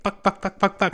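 high mock-furious string of curses; hammed-up cursing burst; angry swearing. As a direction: spit out the swears in a pretend rage. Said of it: "He'd be like".

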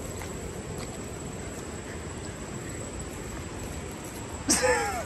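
high airy laughter; breathy laugh. Real, not acted. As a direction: laugh at her face. Laughing at muddy dog.

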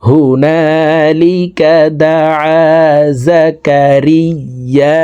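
slow, drawn-out chanted recitation; Quran recitation. Shown as a demonstration. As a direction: intone it slowly like tilawat. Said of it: "তাসদিদওয়ালা হরফ দুইবার উচ্চারণ করিয়া পড়িতে হয়". (ধীর সুরেলা তিলাওয়াত)

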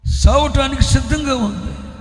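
desperate plea. Acted. Mother pleading her daughter is near death, loud plea. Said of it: "అని ఆయనను వేడుకుంటుంది".

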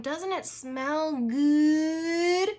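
slow, dreamy drawn-out moan.

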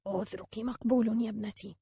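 put-on soft, kindly old man; tender, quiet acceptance; gentle. A narrator's reading. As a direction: forgive her softly. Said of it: "قال الرجل المسن بلطف".

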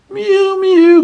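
high mew mew, like a cat at the door; high plaintive cat mew. A narrator's reading. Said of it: "cried Simpkin".

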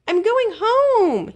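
very high, slow, delighted; joyful cry. A narrator's reading. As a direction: savour the happy news. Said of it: "he cried".

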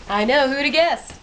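ordinary delivery

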